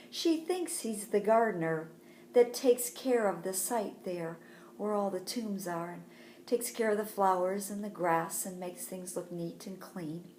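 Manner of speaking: usual speaking voice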